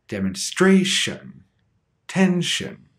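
"demonstration" stressed on STRA, "tension" on TEN; stress before the suffix. Shown as a demonstration. Teacher showing suffix rule, "demonstration, tension" stressed just before the ending. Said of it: "has the stress immediately before".

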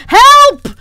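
very loud, high yell; cry for help. Real, not acted. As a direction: yell out helplessly, pitch shooting up. Cries out for help, stuck.

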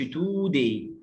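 today as "TOO-day", slow first syllable; wrong long oo. Shown as a demonstration. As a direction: say today with a stretched oo. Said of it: "ما نقولش".

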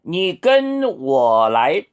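very slow, syllable by syllable; slow model sentence. Shown as a demonstration. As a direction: model it slowly for learners. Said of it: "이렇게 하세요".

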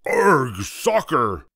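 slow, drawn-out pirate arg; pirate yell. A narrator's reading. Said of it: "kicked the ball and yelled".

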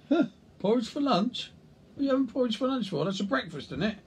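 high, incredulous colleague; scoffing, high-pitched mock surprise; mock disbelief. Mimicking a colleague questioning porridge at lunch.

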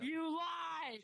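loud, high yell of you lie; shouted accusation. Acted. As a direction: yell it right in their face. Escalating taunt, shouted.

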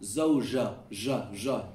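"zawja" with J softened to zh; Moroccan-style jeem. Shown as a demonstration. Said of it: "Like the Moroccans would say".

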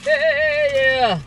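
high, loud joyful squeals; excited squeals. Real, not acted. Vlogger praising God for free contacts, squealing high with joy.

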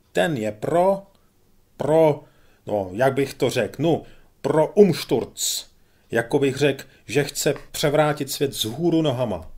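a lightly voiced major carried away by zeal; fervent pompous major.

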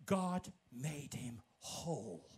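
low, slow, soft English quote; solemn scripture quote. Acted. Preacher quotes the Amplified Bible to the congregation, low and slow. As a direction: let each word land slowly and softly. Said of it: "De Bijbel zegt".

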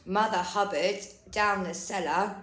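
plain reading voice

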